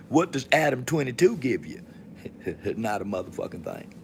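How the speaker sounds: ordinary talk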